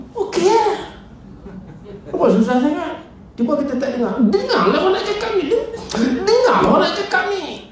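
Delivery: high, laughing mock nag of a wife; nagging wife. Wife scolding her distracted husband, high laughing nag. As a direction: squeal it like a wife fed up with a husband who won't listen.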